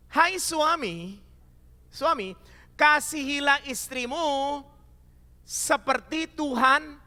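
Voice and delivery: preached slowly, each word weighed; slow verse reading. Acted. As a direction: read the verse like a pastor. (membaca ayat dengan lambat)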